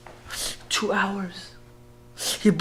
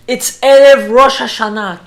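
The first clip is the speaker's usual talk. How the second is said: loud, raised-pitch appeal; insistent plea; 6 semitones higher, 13 dB louder than usual. Retold or imitated. Rabbi asks Gestapo to let him in, insistent plea. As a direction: press the guard, raising your voice on the holiday. Repeats plea to guard with force.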